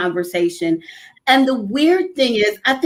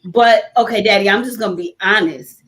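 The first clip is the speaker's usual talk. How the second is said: ordinary talk, nothing marked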